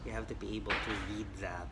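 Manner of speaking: ordinary talk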